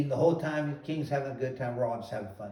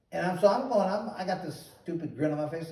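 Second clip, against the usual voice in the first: ordinary talk, nothing marked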